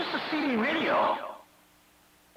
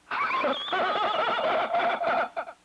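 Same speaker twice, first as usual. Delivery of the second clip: long, high laugh, trailing down; taunting laugh; 8 semitones higher than usual. Real, not acted. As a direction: let out a long high cackle after the threat. Laughing after threatening Greg.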